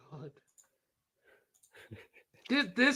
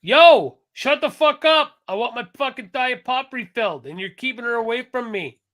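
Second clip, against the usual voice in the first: loud, higher angry yell at the server; louder and higher, furious retort; 4 semitones higher, 4 dB louder than usual. Retold or imitated. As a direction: bark it at the server. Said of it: "I would just be like".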